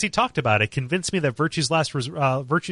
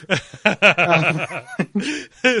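big burst of laughter; cracking up hard; loud laugh; 5 semitones higher, 6 dB louder than usual. Real, not acted. Reacts to the joke.

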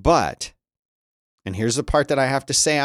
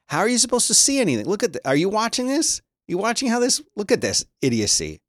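exasperated rant; 10 semitones higher than usual. Real, not acted. Ranting at the CNN website.

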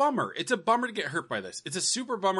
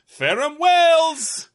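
much higher, louder, very slow "Ferrum Wells"; mock British sign-off; 10 semitones higher, 8 dB louder, much slower than usual. Co-host ends the joke podcast in a very slow, higher, louder mock British accent. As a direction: stretch out a grand British farewell. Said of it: "our famous sign-off".

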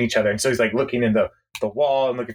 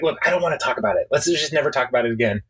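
rushed; much faster than usual. Retold or imitated. Andy rushing to drop subject.

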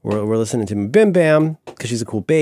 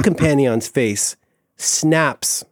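usual speaking voice

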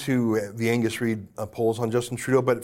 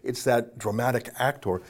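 ordinary talk

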